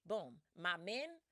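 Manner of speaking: ordinary talk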